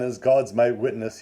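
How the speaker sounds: ordinary delivery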